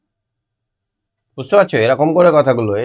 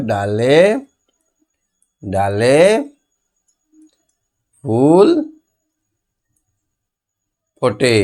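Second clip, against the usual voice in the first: dictation; much slower than usual. Shown as a demonstration. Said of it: "এখানে লিখে দাও".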